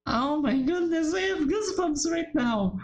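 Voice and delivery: high, awed and spooked; real shock. Real, not acted. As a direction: gasp it out as the scene creeps you out. Player reacting to scary scene.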